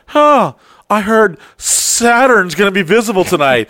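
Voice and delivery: sly hinting spouse. Retold or imitated. Secret listener hinting to their spouse, mock casual. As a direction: drop a hint with feigned casualness. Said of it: "they're just like".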